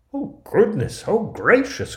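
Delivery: high, loud dwarf exclamation; storybook dwarfs bowled over with wonder; astonished cry. A narrator's reading. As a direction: cry out amazed in a squeaky dwarf voice. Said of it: "crying in their astonishment".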